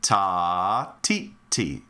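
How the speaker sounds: TA held long, each TI short; rhythm syllables. Shown as a demonstration. Teacher modelling a rhythm, ta drawn out, tis short. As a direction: chant a long "ta", then two brief "ti"s. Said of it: "my rhythm now sounds like this".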